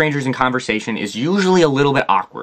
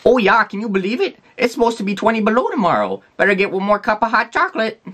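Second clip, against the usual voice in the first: put-on higher, cheery Minnesotan accent; chatty Minnesotan; 8 semitones higher than usual. Minnesota coffee shop employee chatting about the cold, higher and cheery. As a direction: chirp along like a friendly small-town barista. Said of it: "in Minnesota would at least say".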